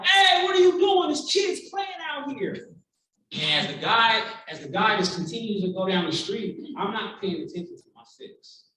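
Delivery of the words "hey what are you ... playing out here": high, loud shout at a passing car; reenacted protective dad's shout; angry yell. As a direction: yell out at a careless driver. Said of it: "I'm yelling at the car".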